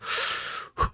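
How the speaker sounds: long airy sigh to sober up; steadying sigh. Retold or imitated. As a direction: blow out a breath to pull yourself together. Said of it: "Deep breath, deep breath".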